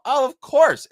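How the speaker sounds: high, loud sarcastic "of course". Acted. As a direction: scoff at it. Mocking healthcare integration.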